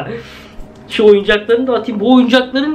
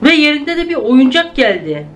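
startled excitement; 6 semitones higher than usual. Real, not acted. Creepy toy appears, baby on ceiling.

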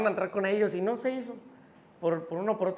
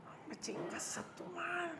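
slow, high, strangled muttering; imitated muted curse; 10 semitones higher, 15 dB quieter, slower than usual. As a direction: squeeze out a soft curse in mock frustration. Revive su frustración con la paloma. (maldición ahogada, lenta y aguda)

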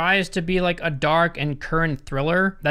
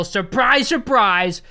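playful reveal; 5 semitones higher, 4 dB louder, slower than usual. Real, not acted. Announces the plot twist playfully.

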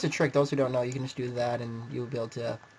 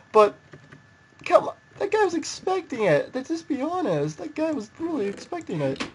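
delighted squeal; 11 semitones higher, 6 dB louder than usual. Real, not acted. Delighted after win.

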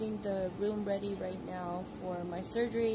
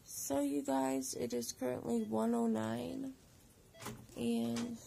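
ordinary delivery

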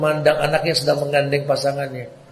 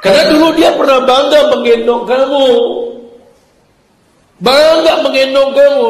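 louder and higher, fervent sermon; impassioned preaching; 6 semitones higher, 6 dB louder than usual. Acted. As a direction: preach it with rising fervor. Preaching at full force. (khotbah berapi-api, lebih keras dan tinggi)

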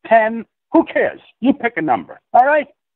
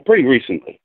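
usual speaking voice

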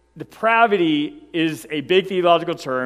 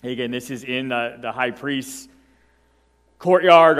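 ordinary talk

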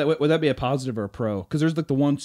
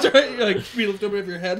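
normal talking voice

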